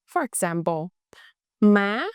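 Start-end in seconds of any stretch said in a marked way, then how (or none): 1.6-2.0 "má" with pitch going up quickly; louder "má" in the rising sắc tone. Shown as a demonstration. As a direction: lift "má" sharply at the end. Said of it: "it's a rising tone".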